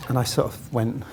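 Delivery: ordinary talk, nothing marked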